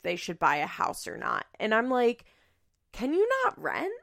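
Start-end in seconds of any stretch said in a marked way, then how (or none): 2.9-3.9 pitched way up in mock disbelief; incredulous question. Telling the couple on the show, high and incredulous. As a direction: toss it out like the obvious answer. She retells her own reaction.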